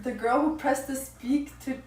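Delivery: ordinary talk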